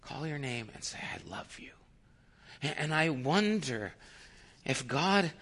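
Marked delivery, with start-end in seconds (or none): 1.0-1.7 hushed retold endearment; barely voiced, very soft; tender whisper. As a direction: whisper it lovingly. Endearment retold at story's hush.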